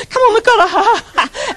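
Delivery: laughing. Retold or imitated. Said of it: "he was just".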